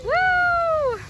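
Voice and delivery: loud, high whoop; watching the ride splash down, whooping; cheering woo. Real, not acted. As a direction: cheer them on at full voice.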